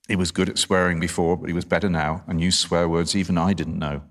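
storytelling aloud, softening for the quoted words; story narration. Acted. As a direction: read the passage out clearly to an audience. Reads novel passage aloud.

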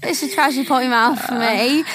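mock British accent, lightly done; a light British reality-star twang. Trying a British accent on her co-host's phrase. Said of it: "I can kinda do the British accent better".

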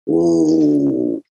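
low, dull "Oh" in a put-on voice; deflated oh. The fans realise who Winston is, a low deflated "Oh". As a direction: let the "Oh" drop, sheepish. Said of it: "they were kinda like".

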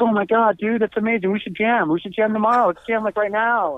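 high-pitched, eager excitement; gushing sudden friendliness. Retold or imitated. John suddenly wanting to jam with the drummer, gushing excitement. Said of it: "turned into the sweetest, nicest guy".

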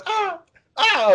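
high mock yelps of pain; imitated pained cries. Retelling the whipped wrestler's cries in a high yelp. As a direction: squeal like the guy getting whipped. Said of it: "the guy that he's hitting's going".

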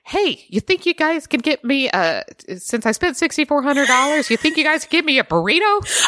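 imitating an irritated Randall; mock exasperated. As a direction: sarcastically demand it, annoyed. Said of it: "Randall gets even madder".

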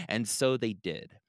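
ordinary delivery, nothing marked

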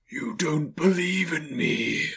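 high, slow, drawn-out spectral voice; eerie, elongated accusation; Marley's ghost. A narrator's reading. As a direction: wail it slowly as a spirit. The ghost confronts Scrooge.

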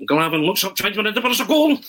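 high-pitched football commentary rush climbing to the goal; Arthur Montford impersonation. Acted. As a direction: build up like an excited TV commentator. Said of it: "used to do an impersonation of you".

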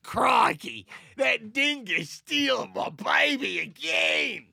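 loud, high, drawn-out Australian caricature; hammed-up Australian wail; Australian accent. Parent crying out about a dingo, hammed-up Australian accent. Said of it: "I wanna hear your Australian accent".

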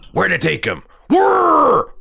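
gravelly mock Daryl, then high whir; imitating Daryl's line with a whirring noise; Daryl Dixon line read. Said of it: "I'm gonna try to do it".

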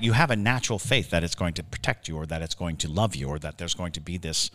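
normal talking voice

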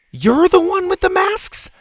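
very high, incredulous shouted question; shouted disbelief. A narrator's reading. Bo confronts the unmasked culprit, shouting in disbelief. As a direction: shout it out, astonished. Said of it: "Bo shouted".

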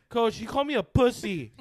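high, loud mock complaint; tattling kid. Kid runs to the coach to report an insult, mock tattling. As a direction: imitate an outraged kid telling on someone.

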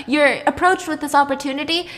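plain reading voice

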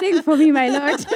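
playful prayer, laughing; laughing plea. Real, not acted. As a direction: tease the Lord to sing.

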